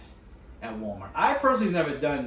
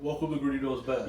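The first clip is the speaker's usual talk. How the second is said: forcing a deep voice. Pretend. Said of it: "you are faking the voice".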